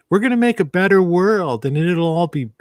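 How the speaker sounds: high, gushing parody of dreamy idealists; mocking idealist voice. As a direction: lampoon the utopian promises up high. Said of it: "it's like the A O Cs and these people".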